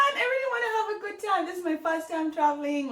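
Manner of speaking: high, rushed mock-panicked plea; freaking out. Stranded first-time traveller praying to God, mock freak-out. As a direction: wail it quickly, hamming up the panic. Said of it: "I'm just freaking out".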